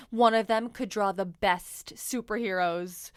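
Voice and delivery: plain reading voice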